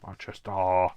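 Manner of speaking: booming, hammed-up Manchester accent; exaggerated Manchester. Shown as a demonstration. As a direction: belt out Manchester with a put-on local twang. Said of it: "I have to say it like that".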